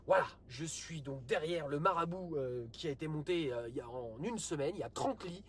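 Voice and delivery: low, subdued military chief; deep, soft official statement; army chief impression. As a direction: play an army chief giving a quiet briefing. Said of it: "T'as le chef des armées là qui a parlé".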